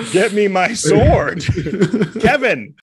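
high, slow mock-heroic call; hammed-up command. Comedian calling Kevin for his sword, hammed-up command. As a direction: summon your sword with mock drama.

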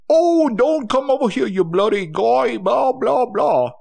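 a hammed-up Brit telling someone off; mock British accent. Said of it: "that's my British voice".

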